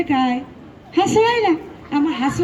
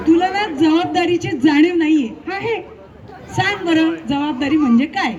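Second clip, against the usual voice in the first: ordinary talk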